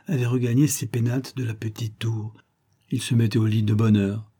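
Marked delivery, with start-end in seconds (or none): none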